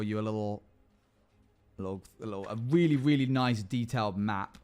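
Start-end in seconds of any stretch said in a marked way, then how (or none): none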